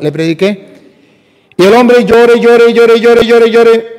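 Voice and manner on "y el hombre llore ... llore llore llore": loud. A narrator's reading. Preacher building to climax, repeating.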